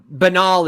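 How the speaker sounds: "Banal" said the way a lot of people pronounce it, as buh-NAHL; common pronunciation. Shown as a demonstration. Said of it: "how a lot of people pronounce it".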